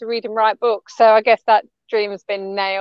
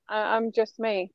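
I'm just me dropped much softer; trailing off; 10 dB quieter than usual. Real, not acted. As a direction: let it fade almost to nothing. Guest repeats, trailing off.

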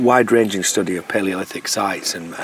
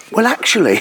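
prim know-it-all voice; 10 semitones higher than usual. Retold or imitated. Imitating woman butting in.

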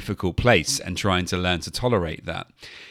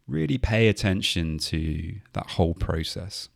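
guided meditation. Acted. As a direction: gently talk them through the breath. Leads a mindfulness body scan.

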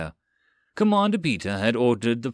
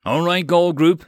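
over a throat mic radio; clipped pilot radio call; 6 semitones higher, 7 dB louder than usual. A narrator's reading. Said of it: "he triggered his throat mic".

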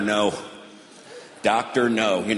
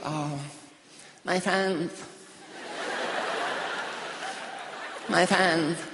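put-on higher whiny teen wail; distraught daughter; 4 semitones higher, 4 dB quieter than usual. Daughter at the mall wailing that her friends aren't there. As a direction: wail it like a dramatic teenager. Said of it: "She's like".

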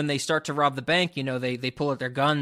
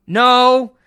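curt loud no; 8 semitones higher, 11 dB louder than usual. Retold or imitated. Husband's curt loud reply.

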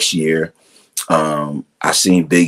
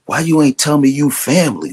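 higher-pitched mock demand; reproachful retold question; 8 semitones higher than usual. Bandleader's new acquaintance confronting him over family, higher-pitched reproach. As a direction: retell a puzzled complaint, pitched up.

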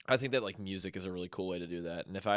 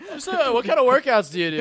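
loud laughing question; squeezing words out through laughter; 14 dB louder than usual. Real, not acted. Still laughing at the bit.

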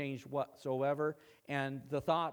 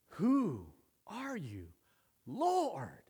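awed questioning; 4 semitones higher, much slower than usual. Acted. Saul replayed in his awed moment.

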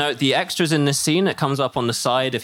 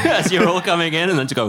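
usual speaking voice

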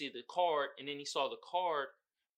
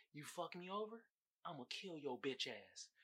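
quiet threat; 2 semitones lower, 17 dB quieter than usual. Retold or imitated. Betrayed woman to her gangster lover, quiet threat. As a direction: threaten softly, well under your usual volume.

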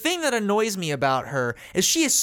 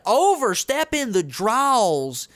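slow, higher overdone Southern drawl; exaggerated Southern drawl; 5 semitones higher, slower than usual. Shown as a demonstration. Explaining a forced accent, in an overdone Southern drawl. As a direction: stretch it out in a hammed-up Southern drawl. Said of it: "you'll have a drawl".

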